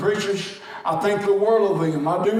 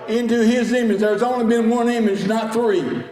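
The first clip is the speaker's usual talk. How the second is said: full-force preaching; 4 semitones higher than usual. Acted. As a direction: call the congregation to shout. Preacher rising to a climax.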